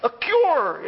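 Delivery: high, slow, mock-grateful yes; mock eager acceptance. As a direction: act out the meek reply he never gave. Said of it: "Did he go".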